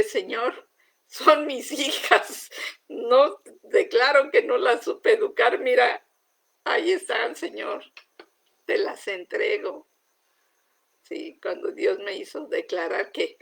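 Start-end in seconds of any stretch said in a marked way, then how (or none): 0.0-9.9 loud, high, breaking surrender; anguished loud prayer. Retold or imitated. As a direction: cry it out to God in surrender. (entrega angustiada y en voz alta)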